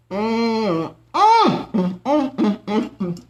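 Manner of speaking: long rising mm of pleasure; savoring hum. Real, not acted. Tasting the homemade biscuits, humming with pleasure. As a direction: hum over every bite.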